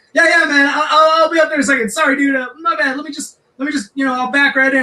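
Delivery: high, eager fake-sorry voice; fake placating. Drunk singer fake-apologizing to the angry guy, high placating voice. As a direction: sweet-talk him while planning to flee.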